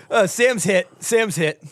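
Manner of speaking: usual speaking voice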